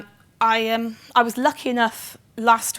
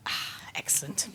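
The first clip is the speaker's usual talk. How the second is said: breathy whispered "excellent" under her breath; whispered aside; 12 dB quieter than usual. Real, not acted. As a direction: murmur it almost to yourself. Aside after audience show of hands.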